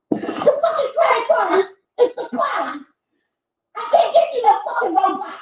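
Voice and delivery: high put-on whiny voice, partly shouted; mocking broke parent. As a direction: squeal it like a flustered broke parent.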